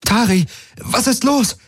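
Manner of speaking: high-pitched alarmed call; calling over noise. A narrator's reading. Kirai asks Tari over the sirens, calling out alarmed. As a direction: pitch it up high to cut through the din. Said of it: "rief sie über den Lärm".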